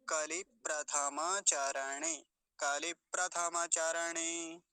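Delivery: कलि प्रथमाचरणे slowly, every vowel sounded; careful कलि प्रथमाचरणे, no vowel dropped; Sanskrit model pronunciation. Shown as a demonstration. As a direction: draw out कलि प्रथमाचरणे syllable by syllable. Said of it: "ऐसा".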